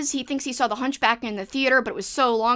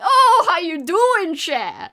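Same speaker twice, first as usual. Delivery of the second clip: drawn-out, loud Cajun greeting, pitched high; Cajun accent; 7 semitones higher, 9 dB louder, much slower than usual. Retold or imitated. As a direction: imitate relatives' heavy Cajun accent, stretching it out. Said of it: "more like what I just did".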